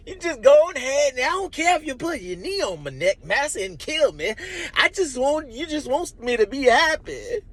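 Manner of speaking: high, mock-cheerful shucking-and-jiving caricature; sarcastic minstrel voice. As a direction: bitterly parody the happy servant begging to please massa. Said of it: "what it sounds like to me is".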